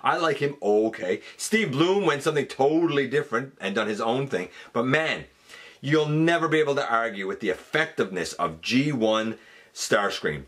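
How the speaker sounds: ordinary delivery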